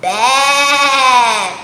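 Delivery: bleating baa; goat baa. Shown as a demonstration. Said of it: "makes the sound baa".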